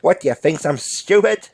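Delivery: exasperated put-on crook voice; indignant bank robber. Robber insisting he drank the potion, comic indignation. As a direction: answer as if insulted by the question.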